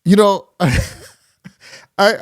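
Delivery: breaking into real laughter mid-sentence; can't hold back the laugh; slow, giggling words between laughs. Real, not acted. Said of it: "I can't really stop laughing".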